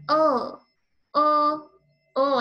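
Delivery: "Oh" with the tongue out; letter sound l. Shown as a demonstration. Teacher showing the lizard sound with tongue out. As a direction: stick the tongue out on "Oh". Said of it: "Your tongue has to come out on that one".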